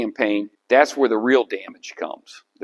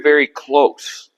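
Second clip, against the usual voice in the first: usual speaking voice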